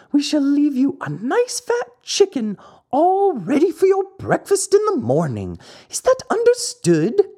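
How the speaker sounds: high, sly weasel voice; wheedling weasel making a deal, pitched high; high, scheming weasel. Acted. Weasel reassures the dog.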